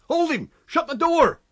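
high, loud frantic orders; urgent high-pitched shouts; crowd shouting. A narrator's reading. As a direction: yell orders in the scuffle. Crowd struggling with invisible man.

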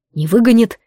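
usual speaking voice

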